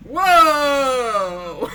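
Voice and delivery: sudden loud whoa of surprise; startled whoa. Real, not acted. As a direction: blurt it out, caught off guard. Players react to game scene.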